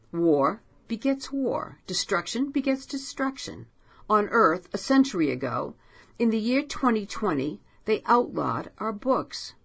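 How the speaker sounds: a hint of a solemn, haunted storyteller; Poe voice. A narrator's reading.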